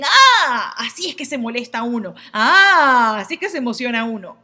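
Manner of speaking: put-on a child learning how feelings work; childlike discovery. Children copying a role model, loud with discovery. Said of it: "toman un modelo y dicen".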